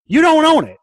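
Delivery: high, loud on "you don't own it"; emphatic warning. Real, not acted.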